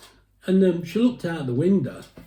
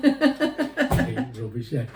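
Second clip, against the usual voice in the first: ordinary talk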